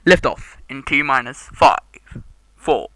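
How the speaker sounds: loud booming launch announcer voice; space announcer voice. Acted. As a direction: call the launch countdown like a big space announcer. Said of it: "space announcer voice".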